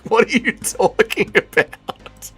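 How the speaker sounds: high, soft, drawn-out incredulity; strained disbelief. Real, not acted. Streamer baffled by chat ranking Mendez above Volk, incredulous. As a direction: stretch the question out slowly in strained disbelief.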